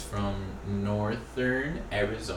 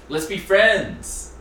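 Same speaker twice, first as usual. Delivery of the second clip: higher, louder, slow and sweet; girly profile voice; 8 semitones higher, 6 dB louder, much slower than usual. Pretend. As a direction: read it like a bubbly girl. Reading profile in put-on voice.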